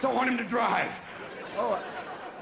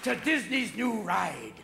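ride announcer. Acted. Announcer welcoming children to a new ride, slow showman delivery. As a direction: announce a ride, stretching the phrases.

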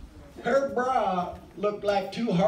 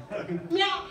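mock cartoon meow; 9 semitones higher than usual. Comic sound effect line.

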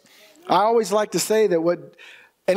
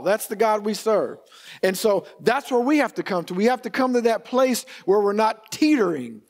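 normal talking voice

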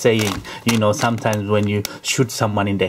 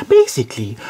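ordinary talk

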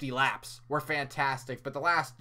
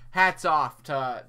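usual speaking voice

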